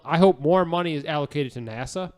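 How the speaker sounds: ordinary delivery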